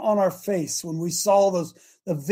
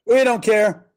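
louder careless shrug of a line; mock shrugging voice; 6 dB louder, slower than usual. Host voicing the public's indifference, loud and dismissive. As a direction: toss it off like the apathetic public.

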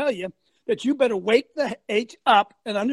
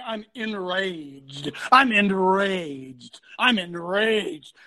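slow, drawn-out mock rage; mock enraged; 2 semitones lower, much slower than usual. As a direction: stretch out "enraged" in mockery. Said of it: "You ever heard anybody say".

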